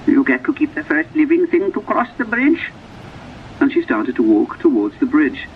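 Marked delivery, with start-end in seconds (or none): 0.1-2.7 put-on high old woman's voice; old woman agreeing knowingly. A narrator's reading. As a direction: repeat the deal in a high old voice.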